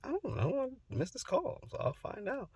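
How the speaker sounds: high, soft dismissive retelling; brushing-off reply. Retold or imitated. Telling mom he missed grandpa's call, high offhand reply. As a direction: pitch it way up and toss it off lightly.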